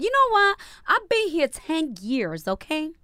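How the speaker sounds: high-pitched sassy insistence, drawn out; sassy retort with swooping high pitch; mock indignation. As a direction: put your foot down, playfully loud then stretched. Acting out a couple's exasperated quote.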